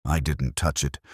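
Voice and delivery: plain reading voice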